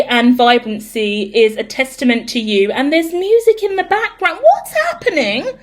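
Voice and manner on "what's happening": startled question. Real, not acted. Surprised by background music.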